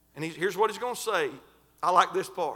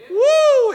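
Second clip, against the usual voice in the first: excited holler; 12 dB louder than usual. Real, not acted. Preacher shouting in excitement.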